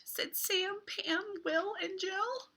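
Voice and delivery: lively picture-book read with voiced characters; reading to children. Teacher reading a beach book aloud to kids, lively. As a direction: read it like story time for little ones.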